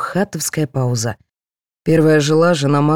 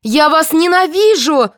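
high, drawn-out cry of hatred; angry cry; 12 semitones higher, 4 dB louder, slower than usual. A narrator's reading. Aida turning on the whole cast, crying out. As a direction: cry it out slowly at high pitch. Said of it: "закричала Аида".

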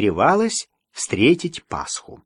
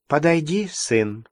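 ordinary delivery, nothing marked

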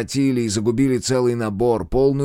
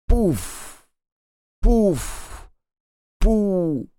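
high breathy gun puffs, fading; dying puffs; 10 semitones higher, much slower than usual. A narrator's reading. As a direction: puff out three dying breaths. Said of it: "трижды выдохнул, умирая".

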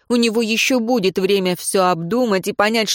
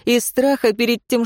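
usual speaking voice

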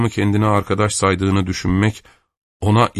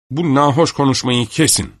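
angry shout; 6 semitones higher than usual. A narrator's reading. Kister losing patience with Avdey, shouts angrily. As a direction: cut him off with a raised voice. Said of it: "diye bağırdı".

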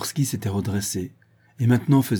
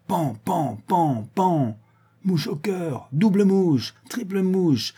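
high, playful bang-bangs; gleeful mock gunshots; 9 semitones higher, slower than usual. A narrator's reading. Druid mimics the shots and scores bull's-eyes, gleeful mock gunshots. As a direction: call the hits in a high, excited voice.

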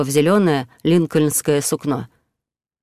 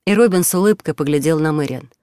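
ordinary delivery

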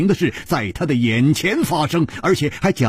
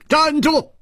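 much higher, loud command to halt; sharp shout; 3 dB louder than usual. Acted. 李玉涵 threatening the group to stop, a loud high shout. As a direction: bark it out like a sudden threat. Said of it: "突听李玉涵一声大喝".

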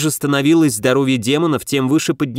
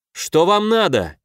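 higher, slow call at ordinary volume; 6 semitones higher than usual. A narrator's reading. Hero calling to approaching demons, a restrained shout. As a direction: hail them from a distance. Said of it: "крикнул я".

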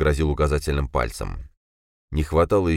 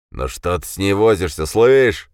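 hoarse voice; 4 semitones higher, 6 dB louder than usual. A narrator's reading. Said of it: "прохрипел голос".

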